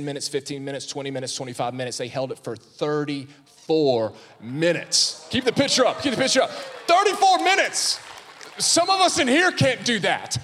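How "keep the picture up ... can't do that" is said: shouting high and loud with delight; excited shout. Real, not acted. Preacher to congregation, proud excited shout. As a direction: crow it out to the whole room. Preacher erupts about the feat.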